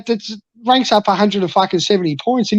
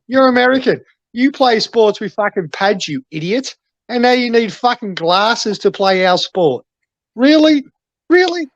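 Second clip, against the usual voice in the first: high-pitched, indignant disbelief; incredulous tirade; 4 semitones higher than usual. Real, not acted. Fan berating an American who tries our game, incredulous. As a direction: scoff at him, pitch climbing on the questions.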